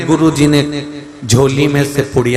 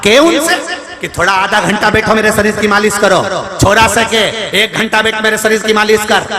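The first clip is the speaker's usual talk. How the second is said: preaching; 7 semitones higher than usual. Acted. Preaching at full force.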